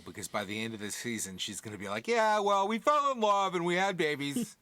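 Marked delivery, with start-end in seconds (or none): 2.1-4.6 high, loud lovestruck gushing; swooning gush. Retold or imitated. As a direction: gush like she's smitten. Mocking Vanessa's future gushing.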